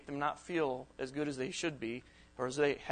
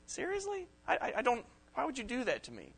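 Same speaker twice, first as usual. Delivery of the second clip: high, stunned "Seriously?" trailing into a hurt question; hurt disbelief; 8 semitones higher than usual. Retold or imitated. Speaker imitating someone hurt by a friend's reply, high disbelief. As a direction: act wounded and baffled.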